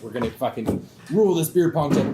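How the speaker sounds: mock swagger of a young drinker; cocky boast. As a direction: puff up, swaggering and loud.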